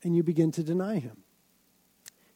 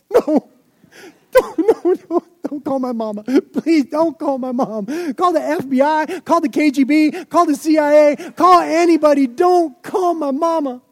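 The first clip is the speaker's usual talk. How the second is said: high, loud, desperate begging; louder, higher, frantic teen plea; panicked pleading; 10 semitones higher, 11 dB louder than usual. Retold or imitated. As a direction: beg like a scared kid caught out. Teen begs not to call mom.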